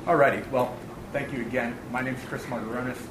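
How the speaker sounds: normal talking voice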